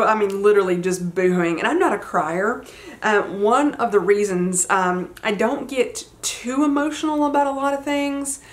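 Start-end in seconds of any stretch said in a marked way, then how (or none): none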